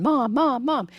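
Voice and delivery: high, insistent calling out; kids calling mom. Retold or imitated. Excited grandkids calling their busy mother, high and insistent. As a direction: pull at Mom's attention.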